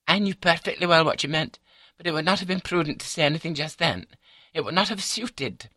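high, composed wife's voice; calm wife. A narrator's reading. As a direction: explain it primly, pitched well up. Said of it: "says she calmly".

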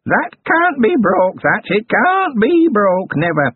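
high, slow cackling crone voice; gleeful goblin crone. A narrator's reading. Goblin granny after the spit handshake, gleeful high crone. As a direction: gloat like an old goblin witch.

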